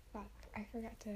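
whispered aside to self; very soft, voiceless self-reproach; under the breath. Real, not acted. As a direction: whisper it, barely audible. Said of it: "Speak up".

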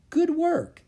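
very high, loud congratulation; enthusiastic praise. Acted. Jack praising Annie after the rescue, enthusiastic. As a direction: cheer a success.

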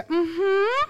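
high, loud fake-agreeable hum; forced politeness. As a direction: hum along with put-on niceness. Forced polite answer to rude people.

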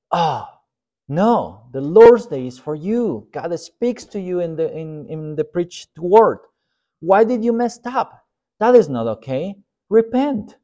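high, emphatic acted scolding; hammed-up righteous indignation; mock outraged rebuke. Pastor plays churchgoer rebuking absent friend, high mock outrage. Acts furious rebuke we never give.

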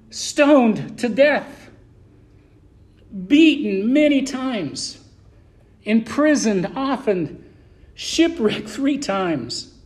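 each trial set down slowly with pauses; slow and weighty. Acted. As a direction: let every hardship land, slowly. Summing up Paul's sufferings.

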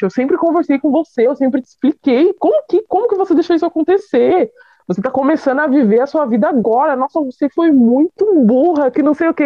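scolding mother. Retold or imitated. Mother berating her pregnant daughter, exasperated scolding. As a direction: pile up the reproaches, pitched high.

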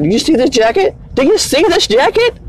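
high-pitched mock indignant question; cocky drunk challenge. Retold drunk man bragging, challenging.

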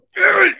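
loud, high throaty outburst; sudden outburst noise. Acted. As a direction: let out a wordless burst of pent-up emotion. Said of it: "you know, like that".

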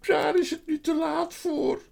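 very high, wailing faun voice; loud high-pitched lament; sorrowful faun. A narrator's reading. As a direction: wail it like a woeful creature. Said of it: "schudde verdrietig zijn hoofd".

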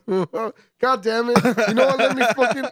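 high, loud, laughing exasperation; exasperated outburst. Real, not acted. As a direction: throw up your hands and yell it. Mock outrage at being mocked.

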